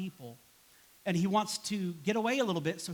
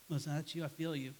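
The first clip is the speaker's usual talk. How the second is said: normal talking voice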